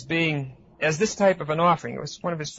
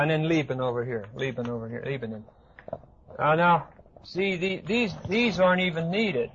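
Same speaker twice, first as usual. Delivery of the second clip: ordinary talk, nothing marked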